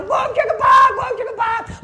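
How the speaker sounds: very high screamed chant; hyped-up pastor. Acted. Pastor jumping up and down, frenzied high shout. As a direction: scream the chant while bouncing about. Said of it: "He's jumping up and down".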